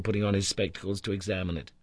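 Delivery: ordinary delivery, nothing marked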